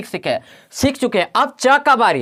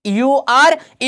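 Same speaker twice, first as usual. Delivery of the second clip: slow spelling; much slower than usual. Shown as a demonstration. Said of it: "अब यहाँ से देखिए यूआर".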